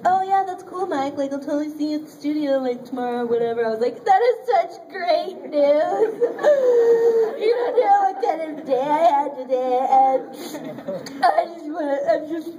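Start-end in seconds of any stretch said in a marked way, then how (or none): 0.1-3.7 put-on airy, quick cool-girl act. Acting the cool girl she wished she was, breezy. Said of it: "like, the cool girl that's like". 4.1-12.5 high, loud wail dissolving into mock sobs; overjoyed meltdown. Retelling her phone call with the director, tearfully overjoyed.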